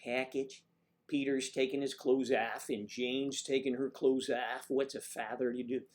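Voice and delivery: exaggerated Midwestern voice; overdone Midwestern accent. Shown as a demonstration. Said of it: "characterization of a Midwest accent".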